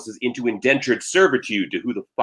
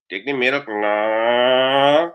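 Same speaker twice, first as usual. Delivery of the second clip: Trinidadian moan with a long rising tail; whining Trinidadian accent; 2 semitones higher, much slower than usual. Acted. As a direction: complain like a mooing Trini cow. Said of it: "constantly whining and complaining".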